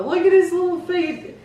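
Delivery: high cooing; overwhelmed by cuteness. Real, not acted. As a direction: coo over tiny feet. Cooing over toy.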